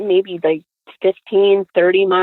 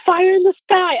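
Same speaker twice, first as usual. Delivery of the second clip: high-pitched retold panic; hammed-up alarm without real tears; mock hysterical; 10 semitones higher than usual. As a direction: imitate your hysterical younger self. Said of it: "I'm in the back hysterically crying".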